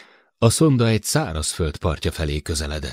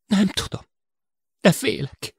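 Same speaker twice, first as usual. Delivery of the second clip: high, tense admission of fear; a hint of panic in a high voice; scared man confessing he is afraid; frightened Sid. A narrator's reading. Sid admits his fear.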